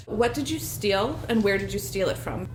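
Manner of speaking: normal talking voice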